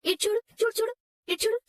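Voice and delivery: put-on high-pitched "look here" to a baby; quick high coaxing calls. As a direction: distract a crying baby in a high voice.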